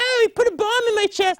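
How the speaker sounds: squeaky high mock wail; mocking falsetto whine. A host mocking a TV plot in a squeaky high voice. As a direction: mimic the show in a shrill squeal.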